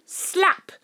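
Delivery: high, sharp spoken slap noise; mock slap. Narrator voices the slap that wakes her, high and sharp. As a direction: bark the slap like a sound effect.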